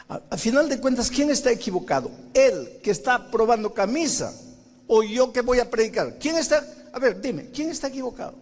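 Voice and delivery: high, loud indignant protest; indignant arguing. Retold or imitated. Preacher protesting to the Spirit, high indignant whine.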